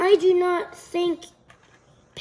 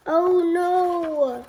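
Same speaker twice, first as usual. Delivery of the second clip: plain reading voice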